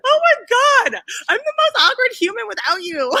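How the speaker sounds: high excited squeal. Real, not acted. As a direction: gush with delight.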